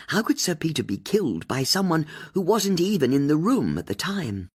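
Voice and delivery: plain reading voice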